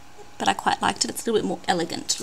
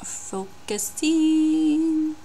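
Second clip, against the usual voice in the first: high, slow sing-song; drawn-out coax; 7 semitones higher, much slower than usual. Real, not acted. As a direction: stretch it out, willing focus. Drawn-out coaxing of camera.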